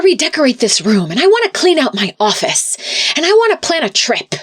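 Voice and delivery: high, fast, excited list of plans; eager quoting father. Retold or imitated. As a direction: race through it like he can't wait. Retelling father's eager new plans.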